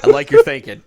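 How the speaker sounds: emphatic, loud approval. Real, not acted. Repeat louder for emphasis.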